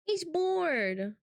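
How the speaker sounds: ordinary delivery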